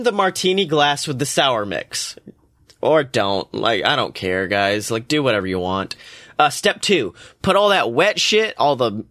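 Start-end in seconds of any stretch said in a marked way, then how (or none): 2.8-5.9 low, throwaway don't-care tone; couldn't care less; careless shrug. Real, not acted. Host shrugs off recipe step.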